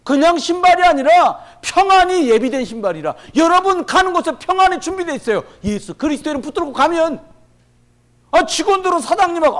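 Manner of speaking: loud, high, impassioned sermon delivery; fervent preaching. Acted. Pastor urging the congregation toward the shoes of peace, fervent loud preaching. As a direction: preach it out, rising with fire.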